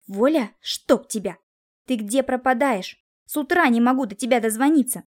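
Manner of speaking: understated cursing reproach; scolding. A narrator's reading. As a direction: lay into him for being unreachable. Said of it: "обрушилась на него с бранью".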